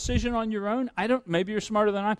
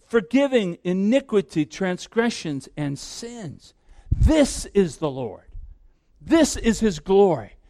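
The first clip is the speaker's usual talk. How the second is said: slow, weighty reading of the passage; solemn scripture reading; 4 semitones lower than usual. Acted. Preacher reading Exodus to the congregation, slow and solemn. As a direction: read it out slowly as holy words.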